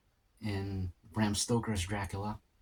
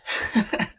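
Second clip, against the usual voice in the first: short breathy laugh; 9 dB louder than usual. Real, not acted. Laughing at bad accent.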